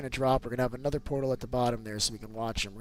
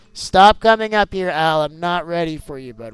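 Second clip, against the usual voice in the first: loud call up; 3 semitones higher, 11 dB louder than usual. Real, not acted. Dad calling up to son.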